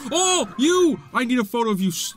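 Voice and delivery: high, quickening exclamation; excited discovery. Real, not acted. Player spots a photo target, high urgent excitement. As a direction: pounce on it with urgency.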